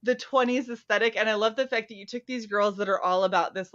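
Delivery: ordinary delivery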